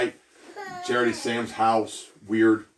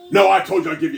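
raised, louder, insistent objection; angry retort; 6 semitones higher, 8 dB louder, faster than usual. Retold or imitated. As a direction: push back hard, offended. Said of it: "he's very angry".

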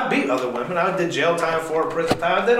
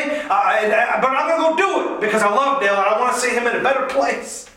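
pleading Jesus voice, much higher and urgent; voicing Jesus; 8 semitones higher than usual. Preacher voices Jesus accepting the bitter cup, high and pleading. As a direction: speak as Jesus choosing the cross out of love. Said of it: "He said".

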